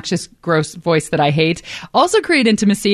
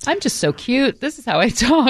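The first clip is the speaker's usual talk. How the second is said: put-on higher, cutesy girlfriend voice; 4 semitones higher than usual. Shown as a demonstration. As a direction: act adorable and sweet. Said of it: "a little higher pitched and maybe a little cutesier".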